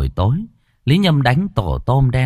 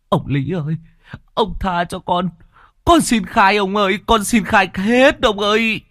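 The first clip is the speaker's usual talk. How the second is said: desperate pleading; 11 semitones higher than usual. Acted. Peasant begging while beaten, frantic high pleading. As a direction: beg for your life under the blows.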